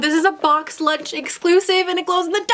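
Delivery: high-pitched, gushing delight; real excited outburst; thrilled excitement. Real, not acted. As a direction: announce the find, bursting with excitement. Thrilled by the collectible.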